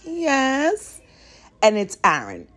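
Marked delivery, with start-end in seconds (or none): 0.0-1.0 high nervous yes. Retold or imitated. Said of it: "I'm like".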